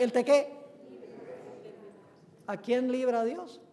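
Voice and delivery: ordinary delivery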